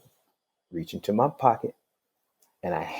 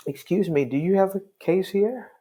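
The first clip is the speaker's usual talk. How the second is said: high, curt, suspicious challenge; dismissive question; 10 semitones higher than usual. Retold or imitated. Judge curtly questioning a visitor, pitched high. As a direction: brush off the stranger, pitched high.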